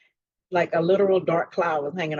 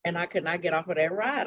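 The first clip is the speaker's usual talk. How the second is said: normal talking voice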